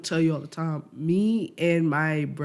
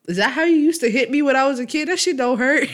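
high, loud, fast teasing; mocking taunt; 8 semitones higher, 6 dB louder, faster than usual. Grown son teasing mom about her weak hits, loud and mocking. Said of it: "and I be like".

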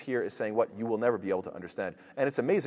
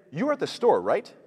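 accusing question; 6 semitones higher, 4 dB louder than usual. Retold or imitated. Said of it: "But if they say".

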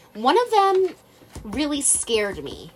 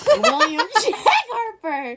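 giggling through the name; high, breaking into laughter; laughing; 11 semitones higher than usual. Real, not acted.

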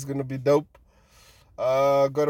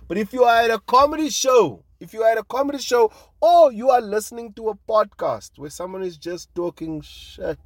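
higher, louder insistent push; emphatic loud; 9 semitones higher, 8 dB louder than usual. Real, not acted. Defending comedians to listeners, a loud insistent point. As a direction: drive the point home loudly.

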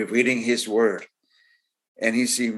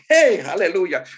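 louder, higher shout of hallelujah; excited praise; 7 semitones higher, 5 dB louder than usual. Real, not acted. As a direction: break out in exuberant praise. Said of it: "I better control myself here".

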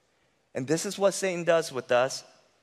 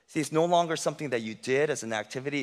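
ordinary delivery

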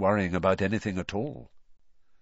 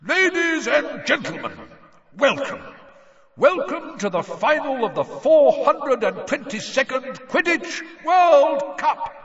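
much higher, louder, booming announcer; magically amplified voice; 7 dB louder than usual. A narrator's reading. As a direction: boom it across a stadium. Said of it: "His voice echoed over them, booming".

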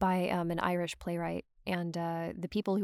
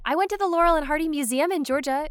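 excited; 8 semitones higher, 8 dB louder, faster than usual. Real, not acted. Excited about the museum.

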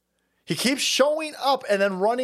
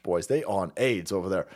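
ordinary delivery

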